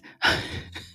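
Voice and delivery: giggle. Real, not acted. Laughs at own joke.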